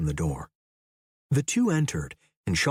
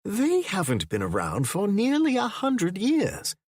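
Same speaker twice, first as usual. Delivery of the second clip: much higher, dismissive English professor; scoffing; 10 semitones higher than usual. A narrator's reading. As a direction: wave off the notion with a scoff. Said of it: "The older man scoffed".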